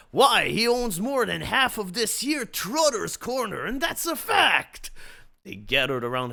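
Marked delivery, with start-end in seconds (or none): 0.1-4.9 loud, higher yell. Acted. Waiter yelling about Lucent, loud yell. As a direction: yell it proudly to the room. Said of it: "spoke up and yelled".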